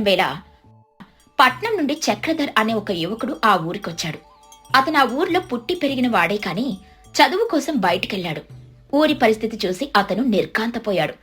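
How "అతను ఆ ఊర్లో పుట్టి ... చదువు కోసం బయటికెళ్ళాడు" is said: usual speaking voice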